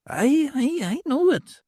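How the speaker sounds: put-on a knowing old beldam; old hag's voice. Old hag Mother Norris speaks.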